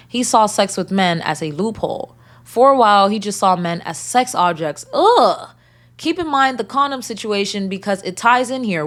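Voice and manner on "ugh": sharp high groan of disgust; disgusted ugh. Real, not acted. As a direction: let out a loud disgusted ugh. Disgusted reaction to story.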